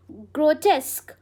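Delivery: ग्रोटेस्क said as gro-TESK, ending on k; ग्रोटेस्क with a hard final k, no yoo; pronounced grotesque. Shown as a demonstration. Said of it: "कैसे pronounce करोगे?". (ग्रोटेस्क का सही उच्चारण)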